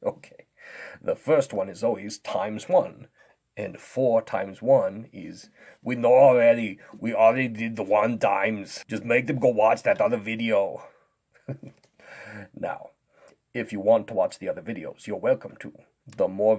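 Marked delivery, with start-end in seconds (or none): 5.8-10.8 loud, bored strongman complaint; impatient strongman. Pretend. Strongman interrupts the times one lesson, impatient.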